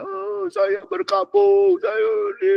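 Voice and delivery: high, slow, drawn-out mourning wail; suara Mordekai berkabung. Acted. (ratapan Mordekai yang tinggi dan lambat)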